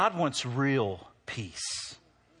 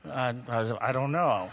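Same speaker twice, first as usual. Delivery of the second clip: mock unsure; 5 semitones lower than usual. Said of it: "everybody's like".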